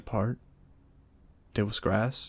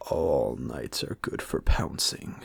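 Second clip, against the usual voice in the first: lower, slow wolf voice; a predator pleased with himself; Wolf amused; 8 semitones lower, slower than usual. A narrator's reading.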